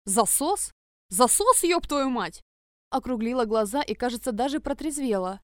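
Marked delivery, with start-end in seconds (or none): 0.1-0.7 furious. Acted. Shocked furious discovery. 1.1-1.6 louder outraged repeat; loud angry. Acted. Second take louder. 1.6-2.4 furious. Acted. Shocked furious discovery.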